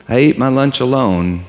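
normal talking voice

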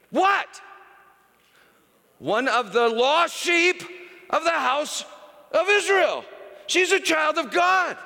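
excited pulpit preaching, shouting at the peak; preaching at full force. Acted. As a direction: preach it with rising fire.

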